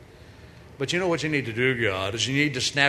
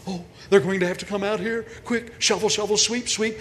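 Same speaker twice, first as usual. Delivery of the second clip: frantic angels; 5 semitones higher than usual. Acted. Angels frantically tidying the barn before the birth, hurried and high. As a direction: rush the angels into cleaning, in a panic. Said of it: "angels were out there nervously waiting".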